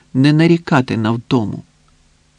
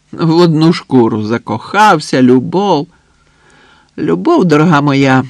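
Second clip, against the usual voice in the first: loud, knowing pronouncement; emphatic know-it-all delivery; worldly-wise expert; 4 semitones higher, 6 dB louder than usual. A narrator's reading. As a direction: lecture your friend like a seasoned expert. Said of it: "з виглядом бувалого знавця".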